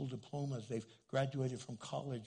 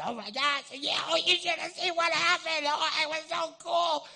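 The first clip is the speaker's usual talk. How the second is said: very high, very loud excited gushing; getting louder and louder; 11 dB louder than usual. Shown as a demonstration. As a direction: blare it out, over-amped and excited. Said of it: "I get louder and louder".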